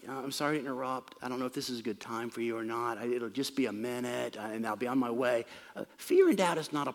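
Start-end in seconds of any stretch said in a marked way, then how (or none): 0.1-5.5 soft, low apologetic interruption; hushed, unobtrusive polite visitor; polite guest. Acted. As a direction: politely excuse yourself, softly and low. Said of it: "a polite guest would say".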